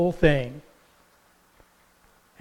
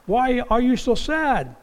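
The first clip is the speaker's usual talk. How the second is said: higher, breezy, innocent greeting; playful Jesus; 8 semitones higher than usual. Retold or imitated. Jesus greets the sad disciples on the road, playfully innocent. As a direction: play dumb and friendly. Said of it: "Jesus was kinda playing".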